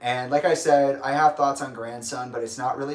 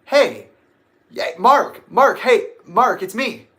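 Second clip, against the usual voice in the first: loud, high-pitched call to get his attention; 8 semitones higher, 9 dB louder, slower than usual. Retold or imitated. Fan hailing the photographer walking past, calling out. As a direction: shout the name to flag someone down. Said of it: "He walked past me and I went".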